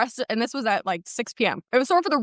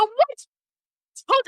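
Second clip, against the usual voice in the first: high, loud startled what; shocked; 13 semitones higher, 3 dB louder than usual. Retold or imitated. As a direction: yelp it in disbelief. Retold shock after being stabbed.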